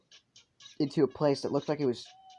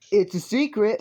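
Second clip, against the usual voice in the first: playful announcement; 9 semitones higher, 8 dB louder than usual. Real, not acted. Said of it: "playing secret music".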